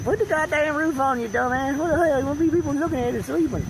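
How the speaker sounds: high put-on scolding voice; high hammed-up telling-off; scolding auntie. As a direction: chew them out in a high, indignant impression. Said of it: "Auntie Mama probably said".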